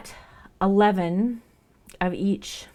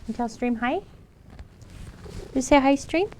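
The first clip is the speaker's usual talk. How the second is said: sing-song prompt; pet talk; 9 semitones higher, 6 dB louder than usual. Real, not acted. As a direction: coax the cat to greet stream. Prompting cat to greet chat.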